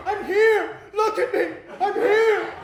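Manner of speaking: loud, high desperate yells to be noticed; desperate scream. Retold or imitated. Lonely man screaming to be noticed on the streetcar, loud desperate yell. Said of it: "I wanted to scream".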